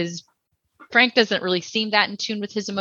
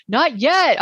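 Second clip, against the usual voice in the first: higher, drawn-out yelled retort; mock yell; 7 semitones higher, 3 dB louder than usual. Kevin yells back at Sue, mock yell. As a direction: lightly imitate a kid yelling back. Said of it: "Kevin yells back at her".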